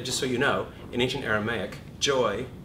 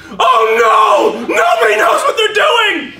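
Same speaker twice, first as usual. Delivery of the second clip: high, loud panicked yell; panicked shout; 19 dB louder, slower than usual. Acted. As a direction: scream it like the world is ending. Teacher acts panicked outburst.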